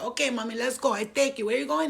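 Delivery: put-on high-pitched, eager Dominican accent; Dominican cabbie impression. Cab driver agreeing to take her home, Dominican accent. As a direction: hustle the fare in a Dominican accent. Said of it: "you know the Dominicans".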